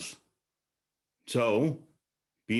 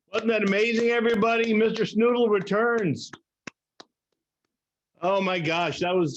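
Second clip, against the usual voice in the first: excited; 8 semitones higher than usual. Real, not acted. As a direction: cheer the act, up and bright. Emcee hypes the finished act.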